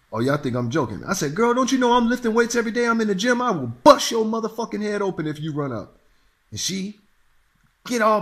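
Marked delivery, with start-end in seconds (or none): 1.4-6.0 rapid threat. Retold or imitated. Speaker threatens the woman.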